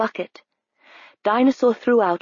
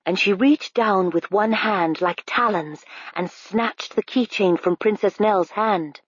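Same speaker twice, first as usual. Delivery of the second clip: ordinary delivery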